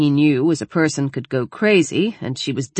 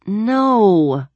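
condescending; 6 semitones higher, 3 dB louder than usual. A narrator's reading. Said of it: "as if he was a moron".

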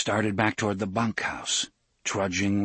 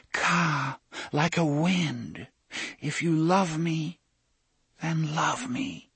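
a lightly voiced young woman, higher and slow; Susan's voice; 6 semitones higher, slower than usual. A narrator's reading. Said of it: "And Susan's voice too".

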